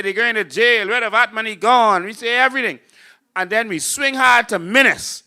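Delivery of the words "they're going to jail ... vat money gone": mock outrage. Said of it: "Then we say".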